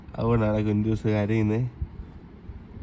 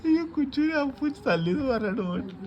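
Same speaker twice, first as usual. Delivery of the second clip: mock crying; 12 semitones higher than usual. Parent mock-wails over the child.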